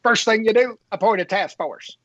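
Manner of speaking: brisk folksy Perot take; Ross Perot imitation. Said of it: "You want the Ross Perot imitation?".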